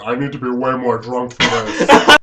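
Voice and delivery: man doing a woman's voice. Shown as a demonstration. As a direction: put on your girl voice. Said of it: "start using my girl voice".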